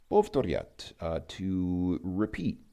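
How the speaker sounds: normal talking voice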